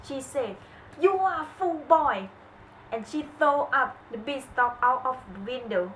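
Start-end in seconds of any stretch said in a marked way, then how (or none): 1.0-2.3 sharp, loud, higher scolding mother; angry scolding. A narrator's reading. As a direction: scold him as a furious mother. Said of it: "his mother was very angry".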